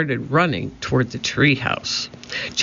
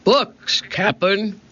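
high pirate crewman's call; shouting down; 7 semitones higher than usual. A narrator's reading. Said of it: "Pinky shouted down".

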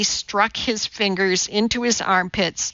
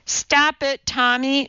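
restrained shout, pitched up; sharp higher-pitched order to stop; 7 semitones higher than usual. A narrator's reading. Teacher orders chimping Tommy to stop, shouted. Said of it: "Missus Mudford shouted".

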